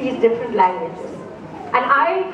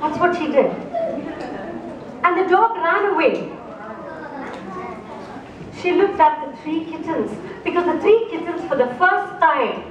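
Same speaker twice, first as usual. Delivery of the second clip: storytelling narration. A narrator's reading. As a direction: draw the listeners into a fairy tale. Storyteller tells a cat fable.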